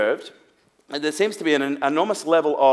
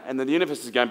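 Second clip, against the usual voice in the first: ordinary delivery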